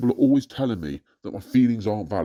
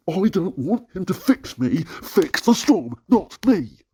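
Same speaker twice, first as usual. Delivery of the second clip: much higher and louder, slow defiant cry; angry protest; 11 semitones higher, 4 dB louder, slower than usual. Real, not acted. Ranter protesting to God, angry and defiant. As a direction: insist on it, fix the storm. Arguing against God's plan.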